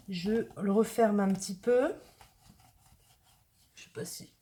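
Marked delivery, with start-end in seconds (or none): 3.8-4.3 whisper. Real, not acted. Chuchote soudain.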